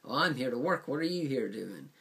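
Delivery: hammed-up pleading to be left alone; exasperated interviewer. Interviewer shooing away a pest, in loud mock exasperation. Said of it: "he comes out and goes".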